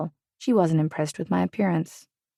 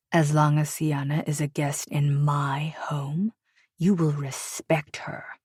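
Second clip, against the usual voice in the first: snarled warning; slower than usual. A narrator's reading. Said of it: "he snarled".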